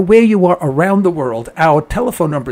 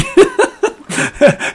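high laugh. Retold or imitated. Son laughing on phone.

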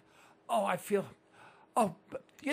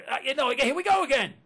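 ordinary talk, nothing marked

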